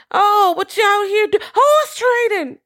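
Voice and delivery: very high, slow, falsely innocent voice; imitating Jupe. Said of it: "Jupe pull up?".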